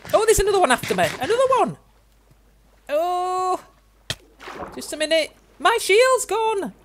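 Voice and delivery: high, loud, alarmed cries; panicky. Real, not acted. Gamer losing his shield to attackers, high panicky cries. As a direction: yelp as the enemies close in.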